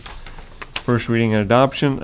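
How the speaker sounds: normal talking voice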